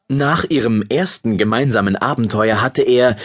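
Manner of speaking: usual speaking voice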